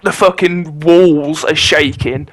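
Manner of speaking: ordinary delivery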